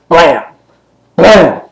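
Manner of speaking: punchy mock gun blasts, high and loud; high, punched gunshot noise; imitated gunshots. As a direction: fire off two spaced comic-book shots. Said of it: "And you hear".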